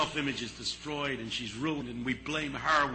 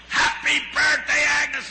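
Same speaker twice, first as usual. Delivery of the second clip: much higher and louder, a slow surprise shout; yelled greeting; 13 semitones higher, 9 dB louder, slower than usual. Retold or imitated. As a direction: throw the birthday cheer at the door. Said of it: "we yell".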